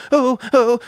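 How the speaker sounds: high, whimpering timid imitation; exaggerated meek voice; mock timid. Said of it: "all fucking timid and stuff".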